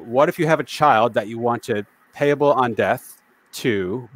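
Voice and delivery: usual speaking voice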